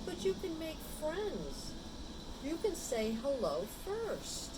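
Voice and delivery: a hint of a reassuring owner, pitch lifted; encouraging owner. A narrator's reading. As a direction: gently coax a shy friend. Owner encourages shy dog.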